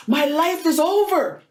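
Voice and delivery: high, slow mock-weeping teen voice; imitated tearful wail. Teen girl in the hallway tells Rosita her life is over, mimicked tearful high wail. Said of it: "she was in tears".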